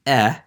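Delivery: "eh." as the E in better; short E sound. Shown as a demonstration. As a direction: sound out "eh." alone, short and open. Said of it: "as the E in better".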